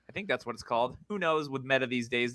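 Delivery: ordinary talk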